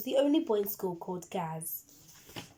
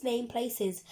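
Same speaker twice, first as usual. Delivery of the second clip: plain reading voice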